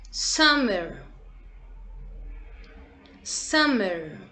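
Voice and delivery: summer said slowly as a model, falling; Summer spelled out in sound for kids to echo; teacher modelling Summer again; model word. Shown as a demonstration. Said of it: "Repete com a tia [name]".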